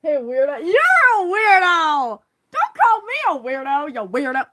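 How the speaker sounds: high, loud, drawn-out weirdo; bickering insults. Acted. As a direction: hurl the insult back playfully. Bickering characters trade insults.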